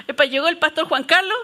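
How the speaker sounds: contando entre risas; riendo. Real, not acted. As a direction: se le escapa la risa mientras narra. Laughs retelling the pastor's shock. (narra entre carcajadas)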